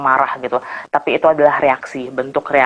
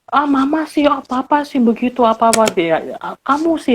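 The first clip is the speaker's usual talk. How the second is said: put-on high, accusing whiny child voice; nada menuding; 8 semitones higher than usual. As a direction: mimic a kid blaming mom, pitched way up. Menirukan anak yang menuding orang tua. (anak merengek menyalahkan mama)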